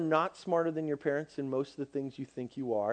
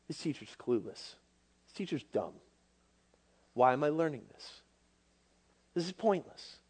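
low, soft grumble; dismissive student; 3 semitones lower, 7 dB quieter than usual. Acted. Bored student thinking about the teacher in class, dismissive. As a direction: grumble it under your breath.

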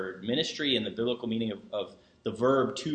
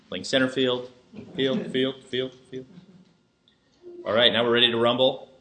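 baseball announcer; 4 dB louder than usual. Pretend. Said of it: "You wanted to sound like a baseball announcer".

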